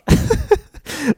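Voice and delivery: sudden squealing laugh; a high, loud laugh bursts out; high burst of laughter. Real, not acted. Laughs after own joke.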